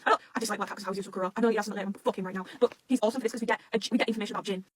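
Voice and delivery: ordinary delivery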